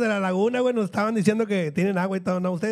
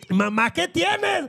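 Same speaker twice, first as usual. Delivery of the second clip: slow, much higher, squawky duck voice; Pato Donald voice; 7 semitones higher, much slower than usual. Retold or imitated. Boy coming home to mom, Donald Duck voice. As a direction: talk like a cartoon duck with a sore throat. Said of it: "hablando como el Pato Donald".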